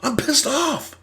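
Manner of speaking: mock telling someone you're pissed off; mock angry. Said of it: "Anger is when you say".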